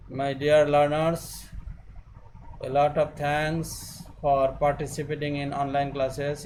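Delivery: slow, measured closing words; slow formal farewell. Acted. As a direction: address the learners slowly and formally.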